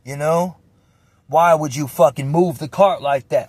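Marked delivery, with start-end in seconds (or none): 1.3-3.5 raised, louder reenacted complaint; retold anger. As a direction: act out snapping at someone over a noise. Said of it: "I'm getting mad because the noise".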